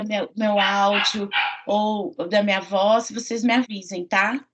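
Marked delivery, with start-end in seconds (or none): none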